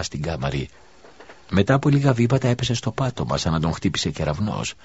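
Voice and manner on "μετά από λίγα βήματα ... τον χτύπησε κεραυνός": ordinary delivery, nothing marked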